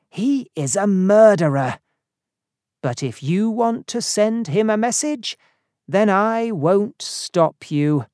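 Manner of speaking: lightly voiced friend, firm but yielding; firm Harrington. A narrator's reading.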